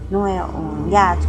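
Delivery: normal talking voice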